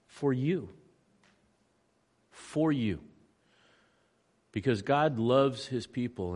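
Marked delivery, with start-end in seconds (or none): 0.1-3.0 tender. Real, not acted. Answer after long pause.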